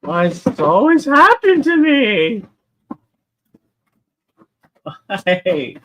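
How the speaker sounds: high, slow, loud wail of self-pity; dramatic lament. Real, not acted. Streamer hunting under the desk, wailing high and slow. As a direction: moan to the heavens. Searching for dropped toy.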